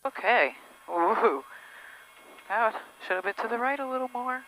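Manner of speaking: usual speaking voice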